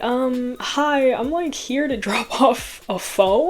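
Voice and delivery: high, halting and drawn-out, rising at the end; awkward announcement. Retold or imitated. Visitor announcing a phone drop-off to showering stranger, awkward and high. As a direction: imitate a hesitant, awkward visitor calling out.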